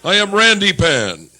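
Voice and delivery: booming, slow, mock-mythic goat boy; loud hammed-up goat man announcement; goat boy persona. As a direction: proclaim it like a lusty satyr god.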